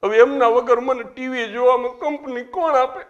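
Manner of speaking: high wailing widow voice, rising; hammed-up sob story of a widow; mock weeping widow. Widow crying to the president about TV serials, mock wail. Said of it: "અને કે".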